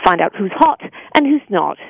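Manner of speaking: snooty British voiceover voice; snotty British. Shown as a demonstration. Voice actor reciting her MTV commercial line, snotty British. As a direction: deliver it in a haughty British accent. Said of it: "this kind of snotty British".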